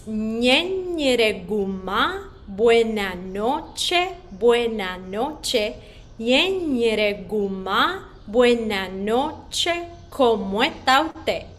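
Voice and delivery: Ñe Ñere Guma spoken slowly and clearly; slow spoken drill. Shown as a demonstration. Teacher leading the class in speaking, slow and clear. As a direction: say it slowly for children to repeat. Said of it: "let's speak it one more time".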